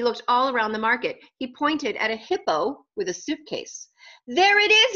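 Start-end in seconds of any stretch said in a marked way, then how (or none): 4.3-4.9 high, loud cry of discovery; storybook shout on spotting it. A narrator's reading. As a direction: shout it out, pitched way up. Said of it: "he shouted".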